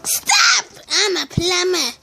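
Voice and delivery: usual speaking voice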